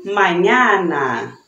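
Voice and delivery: mañana said slowly with a clear Spanish ñ, ma-ÑA-na; slow model word for students, mañana with the ny sound; mañana stretched out slowly, ñ like Portuguese nh. Shown as a demonstration. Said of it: "Por exemplo".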